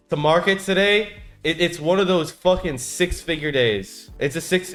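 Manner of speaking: loud, high hyped-up shouting. Real, not acted. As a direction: yell it out, thrilled. Big winning day, hyped.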